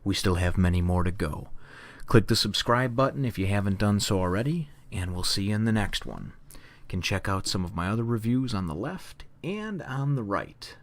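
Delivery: booming recorded outro read; announcer outro. Acted. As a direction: announce it like a channel trailer.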